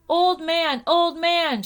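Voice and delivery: high, loud calling out; shouting. A narrator's reading. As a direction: call out to the old man from afar. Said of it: "shouted Chang".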